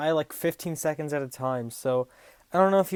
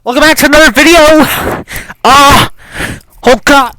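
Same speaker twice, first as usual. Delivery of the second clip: hyped shouting; 10 semitones higher, 21 dB louder than usual. Acted. Dazed greeting after the bomb.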